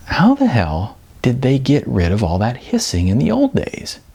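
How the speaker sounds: ordinary delivery, nothing marked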